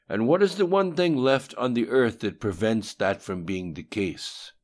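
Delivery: scolding. A narrator's reading. The voice questions Lim Shun like a child who forgot chores, scolding. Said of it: "as though scolding a child".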